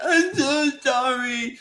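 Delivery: high, slow mock-crying wail; hammed-up weepy squeal; Brooklyn. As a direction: imitate a tearful apology, drawn out. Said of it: "Brooklyn crying".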